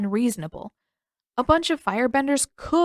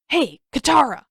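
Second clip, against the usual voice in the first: loud, higher call to get her attention; 6 semitones higher, 5 dB louder than usual. A narrator's reading. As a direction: catch her by surprise with a shout. Sokka grabs his sneaking sister.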